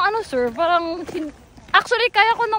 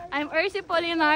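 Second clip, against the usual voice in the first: ordinary talk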